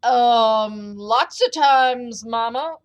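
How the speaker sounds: loud, drawn-out whiny giant; hammed-up sheepish whine; whimpering giant. A narrator's reading. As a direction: whimper like a big kid caught by mama. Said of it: "whimpered the giant".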